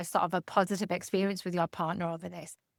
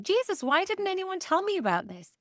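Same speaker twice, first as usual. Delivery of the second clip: retold exasperation, pitched up high; exasperated outburst; 9 semitones higher than usual. She retells her anger at no one warning her, exasperated. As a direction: blurt it out, fed up and indignant. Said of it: "I was like / really pissed".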